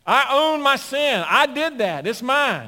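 loud, high shouting sermon; fiery preaching. Acted. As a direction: preach it with full fire. Preaching at full force.

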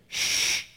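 loud, firm shush; acted commanding shush; hushing shh. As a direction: hush the storm with a strong shh. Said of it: "the truth stood up and said".

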